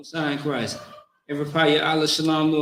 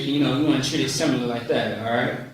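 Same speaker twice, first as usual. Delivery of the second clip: ordinary talk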